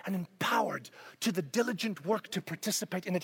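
intense whispered urging. Real, not acted. Preacher whispering his point to the congregation. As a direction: lean in and breathe it out.

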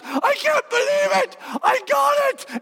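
high, thrilled cry of disbelief; excited. Pretend. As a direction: squeal it like you just won. Imagined excited listener.

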